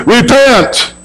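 "Repent." rising high then falling hard; emphatic repent. Acted. Preacher quoting prophets calling the people, emphatic. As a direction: hit "Repent." like a prophet's command.

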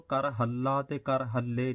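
ਕਰ ਹੱਲਾ ਤੇ ਕਰ ਹੱਲੇ with a long double ਲ; loud demo of ਹੱਲਾ and ਹੱਲੇ with doubled l; doubled consonant. Shown as a demonstration. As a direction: hold the l in both ਹੱਲਾ and ਹੱਲੇ. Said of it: "ਅੱਧਕ ਲਾ ਕੇ ਨਹੀਂ ਪੜ੍ਹਨਾ".

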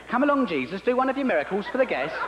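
put-on a pushy mum asking her boy to show off for the guests; nagging mum. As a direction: chivvy the child in front of guests. Mary nags young Jesus.

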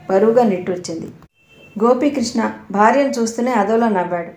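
plain reading voice

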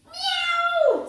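loud, very high yowl; sharp high cat cry; startled cat meow. Acted. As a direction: screech a meow like an alarmed cat. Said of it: "said the cat".

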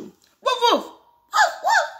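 very high-pitched woofs; dog bark. Acted. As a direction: yap like an agitated dog. Voicing the dog's barks.

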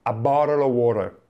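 "A bottle of water" as "borel o worer"; "A bottle of water" American, flapped T, R sounded; American English. Shown as a demonstration. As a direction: model it for the learner to copy. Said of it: "Prova insieme a me".

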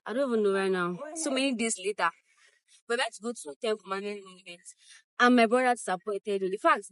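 loud, energetic vlogger intro. Real, not acted. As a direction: pump up the viewers, loud. Upbeat vlog intro outside.

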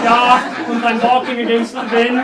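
hammed-up German accent with Ja und and wind as vend. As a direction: play a mock German mime. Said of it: "I'll do it in a German accent".

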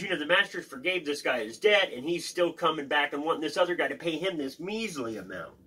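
normal talking voice

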